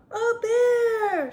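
high, loud storybook scream; drawn-out frightened cry. A narrator's reading. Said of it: "screamed Eddie".

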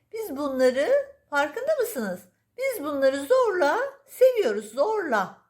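high, cooing pet-talk voice; baby talk to cat. Acted. Owner cooing to her cat, high baby talk. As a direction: gush over the cat like a baby.

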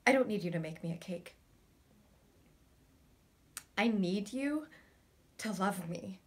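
low, soft, halting plea; quiet plea. Acted. Pleading with someone to love them, quiet and low. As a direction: ask softly, with long pauses.

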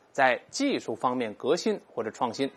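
usual speaking voice

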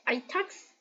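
ordinary delivery

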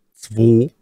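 "zwei" said as "zwo" with a clear o; "zwei" spoken as "zwo" to avoid confusion with drei. Shown as a demonstration. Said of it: "warum habe ich hier nicht zwei gesagt?".

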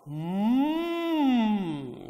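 long loud humming moo; cow moo. Shown as a demonstration. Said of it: "we can depict a cow".

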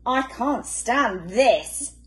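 slow, fed-up complaint; exasperated, slow. A narrator's reading. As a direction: drag it out, fed up. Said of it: "said Mister Bear".

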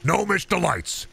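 high, pushy infomercial pitch; hard-sell the gnome pills; supplement pitch. Acted. Host pitching supplements to his listeners, pushy sales voice.

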